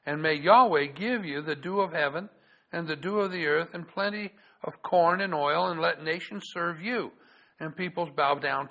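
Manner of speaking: loud, measured Bible reading; solemn public reading of scripture. Acted. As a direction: read it out like the pulpit Bible.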